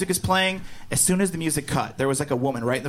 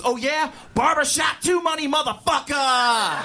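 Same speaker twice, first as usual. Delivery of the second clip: imitating a gloating headliner, higher, slow shout; triumphant shout; 9 semitones higher, slower than usual. Headliner shouting back at the heckler, gloating shout. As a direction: yell it right in her face. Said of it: "he goes right in her face".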